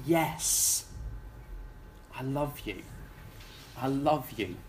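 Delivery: soft, low devotion; tender reply. Retold or imitated. Worshipper answering God, tender and soft. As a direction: murmur it to God.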